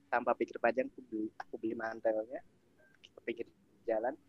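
ordinary delivery

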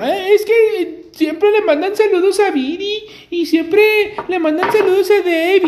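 voz aguda y lenta, imitando un reclamo; reclamo imitado. Retold or imitated. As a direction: imita a quien se queja, muy agudo. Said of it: "a mí sí me dijeron". (voz chillona de reclamo imitada)

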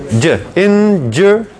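"injury" in slow pieces, "dj", then "en-jer"; injury broken down. Shown as a demonstration. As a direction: sound out "injury" slowly with an odd E in the middle. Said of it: "una E medio rara".

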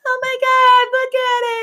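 very high squealing excitement; gushing over puppies. Retold or imitated. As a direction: squeal it like a puppy lover. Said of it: "just sits there and goes".

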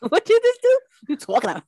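put-on high, loud send-up of his accent; Scott. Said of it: "his accent isn't even that bad".

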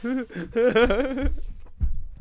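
cracking up; high laugh. Real, not acted. Laughs at joke.

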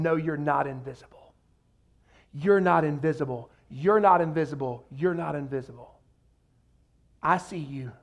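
low, steady, comforting repetition; gentle reassurance. Acted. Voicing Jesus reassuring the overlooked.

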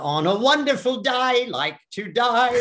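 Eliza Doolittle Cockney, sung high; chirpy Cockney music hall voice; Cockney accent. Shown as a demonstration. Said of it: "Let's have a bit of it now".

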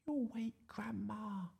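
high, slow, very soft whisper; child's whisper. Acted. Iris warning the lion not to wake Grandma, whispering. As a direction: whisper a warning as a little girl. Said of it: "whispered Iris".

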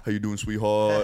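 a hint of a friend's way of talking; Joe. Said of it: "trying to talk like Joe".

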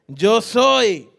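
firm, loud claim; loud declaration. Retold or imitated. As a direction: announce it boldly. Healed blind man declares himself.

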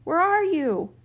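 calling back. A narrator's reading. Son calls back to the voice underground, calling out. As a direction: call out to a hidden voice. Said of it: "And he called back".

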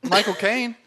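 hint of an imitated British actor; Michael Caine. Co-host showing off accents, mock actor impression. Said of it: "your best Michael Caine accent".

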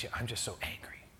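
unvoiced whisper, half-ashamed; whispered confession. The angry stranger confessing to the preacher, whispered. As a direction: breathe it like a secret admission.

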